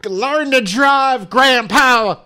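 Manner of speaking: shrieking high rage, slow and stretched; screaming. Retold or imitated. Yelling at the old man in the SUV: furious scream. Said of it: "I just kept screaming".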